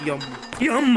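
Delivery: sudden high, loud cry for mama; real jump-scare yell; startled scream. Real, not acted. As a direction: shriek it as the scare hits. Jump scare in horror game.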